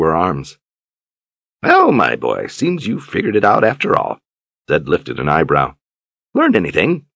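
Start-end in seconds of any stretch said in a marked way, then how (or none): 1.6-7.1 put-on pleased old mentor's voice; knowing old wizard. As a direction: tease him with a knowing question. Zed teasing afterward.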